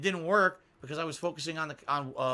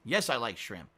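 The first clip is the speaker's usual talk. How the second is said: usual speaking voice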